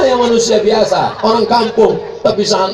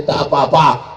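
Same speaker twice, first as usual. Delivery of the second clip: normal talking voice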